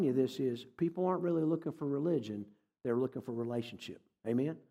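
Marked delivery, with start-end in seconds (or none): none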